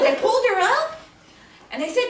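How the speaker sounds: usual speaking voice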